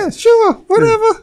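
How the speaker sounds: very high-pitched brush-off; mock agreement. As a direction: echo a silly squeaky dismissal. Mimicking the silly high agreement.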